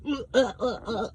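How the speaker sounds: high, repeated "uh" noises; mock tongue-tied stuttering; mocking stammer. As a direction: imitate catching someone out with quick high stutters. Mocking someone caught contradicting herself.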